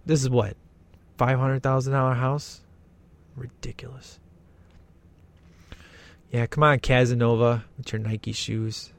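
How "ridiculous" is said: "Ridiculous" dropped to a near whisper; muttered ridiculous. Real, not acted. Commenter repeating to himself about the house, very soft "Ridiculous". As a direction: breathe "Ridiculous" almost silently. Repeats word under breath.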